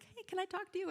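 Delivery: high, tentative imitation of a retiree; retiree asking. Retiree approaching the principal with an envelope, imitated high-pitched request. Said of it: "you know what they're coming to talk to you about".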